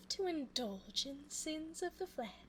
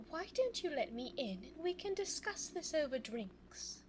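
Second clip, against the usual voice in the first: ordinary talk, nothing marked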